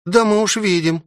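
high-pitched, amused maid remark; wry chuckle. A narrator's reading. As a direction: say it knowingly with a smirk. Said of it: "усмехнулась Руби".

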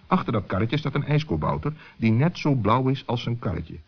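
ordinary delivery, nothing marked

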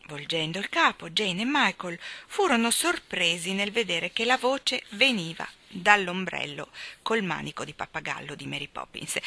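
plain reading voice

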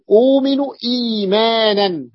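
أؤمن إيمانا with long uu and long ii; lengthened vowels. Shown as a demonstration. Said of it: "فصار ما لدينا مد". (أؤمن إيمانا بمدّ أول كل كلمة)

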